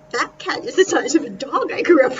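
giggling at how big the cat is; laughing. Real, not acted. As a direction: laugh through the words in amazement. Amazed at cat size.

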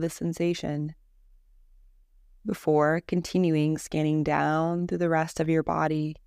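calm, measured Yoga Nidra guidance with long pauses; guided meditation. Acted. Teacher leading a listener through a Yoga Nidra body scan, guided meditation voice. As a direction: lead them gently into rest, leaving space between phrases.

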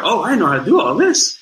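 high, lightly mocking voice of a lost beginner; a touch of helpless confusion; mock flustered newbie. Co-star lost with the camera, mocked in a high voice. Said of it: "she was like".

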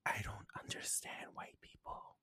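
breathy whispered aside. Retold or imitated. Whispering her thought about the people in line. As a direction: whisper it so only you hear.

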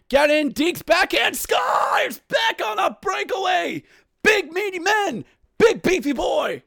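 very high, screamed goal call; excited goal call. Real, not acted. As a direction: explode into shouting as the breakaway scores. Calling a breakaway goal.

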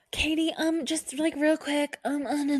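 high-pitched, stalling mimicry; hesitant interrupter. Someone asking the nurse something real quick, hesitant interrupter. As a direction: dither in a high imitation voice.

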